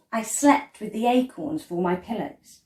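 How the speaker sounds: usual speaking voice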